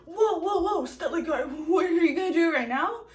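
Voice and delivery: high, loud startled protest; backing off in a laughing panic; mock-panicked whoa, stop; retold alarm. Alarmed as needle approaches.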